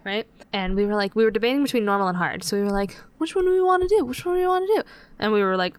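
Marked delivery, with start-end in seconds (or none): 3.2-4.8 higher retold friends. Friends deciding in Overwatch.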